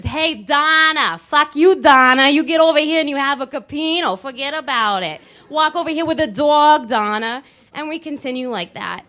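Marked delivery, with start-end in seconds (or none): 0.0-7.4 loud, high hammed-up Italian soprano; mock Italian soprano accent. To friends, hammed-up Italian soprano accent. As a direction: belt it like a mock Italian opera diva.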